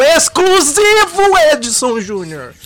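promo voice. Acted. Ad-style promo for the filter.

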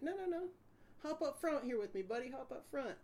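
high-pitched, chummy cop redirect; imitated friendly cop. Cop sending a suspect to the front seat, high imitated chummy voice. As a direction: wave them over in a high mock-cop voice. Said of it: "he's like".

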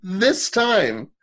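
high, slow, incredulous stress on bleeding; outraged emphasis. Real, not acted. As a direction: spell out the outrage slowly. Outraged retelling of scene.

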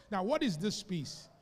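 ordinary delivery

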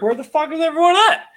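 voicing a confused dolphin. Dolphins in empty canals wondering, high squeaky voice. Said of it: "because they're like".

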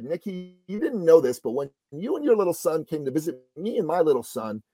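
normal talking voice

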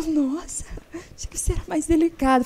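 imitated offended women. Women clients complaining to blunt counsellor, imitated high-pitched dismay. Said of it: "Daí elas falam".

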